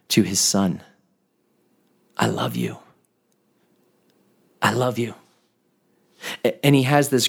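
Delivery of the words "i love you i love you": much lower and softer, tender; tender reassurance. Retold or imitated. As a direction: murmur it like a father holding his hurting son. Father comforting son in pain.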